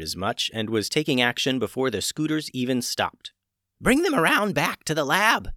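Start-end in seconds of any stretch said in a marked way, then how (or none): 3.8-5.5 loud call over shoulder; yelling. A narrator's reading. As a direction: call it back loudly. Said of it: "she yelled back".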